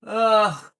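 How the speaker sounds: loud disgusted ugh; disgusted groan. Real, not acted. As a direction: let out a real groan of revulsion. Disgusted reaction to the clip.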